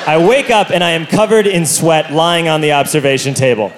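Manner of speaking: usual speaking voice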